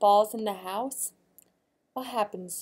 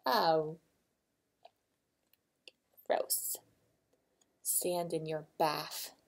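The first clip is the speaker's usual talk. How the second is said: ordinary delivery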